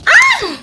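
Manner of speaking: high, loud startled "ah!"; short yelp cutting off the sentence; sudden scream. Real, not acted. Sudden cry cutting off her sentence.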